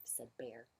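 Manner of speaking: usual speaking voice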